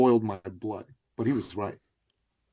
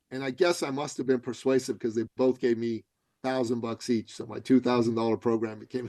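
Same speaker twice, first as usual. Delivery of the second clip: ordinary delivery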